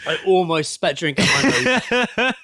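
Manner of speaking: barely getting words out between laughs; laughing. Real, not acted. Laughing hard at the email.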